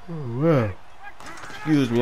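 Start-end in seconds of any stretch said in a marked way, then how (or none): 0.1-0.7 talking through a yawn; words stretched by a yawn. Real, not acted. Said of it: "I'm yawning".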